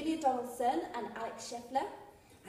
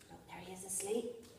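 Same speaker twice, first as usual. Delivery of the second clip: ordinary delivery, nothing marked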